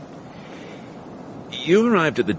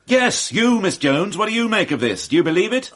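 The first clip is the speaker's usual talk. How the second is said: higher, insistent demand; angry pressing; 7 semitones higher than usual. Acted. Inspector presses Miss Jones, angry and insistent. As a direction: push her hard for an answer. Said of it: "you lot make me angry".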